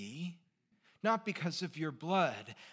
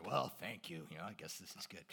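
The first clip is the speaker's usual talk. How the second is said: grudging thanks, dropping softer; grumpy thanks; 8 semitones lower, 8 dB quieter than usual. Retold or imitated. Friends thanking the one who paid, grudging and grumpy. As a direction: thank him like it costs you. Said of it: "we all grumpily, like, thank each other".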